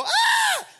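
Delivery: a high, loud shriek of panic; panicked scream. Shown as a demonstration. Preacher modelling the Israelites' panicked scream, high and loud. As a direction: scream like you're trapped. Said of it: "Everybody go".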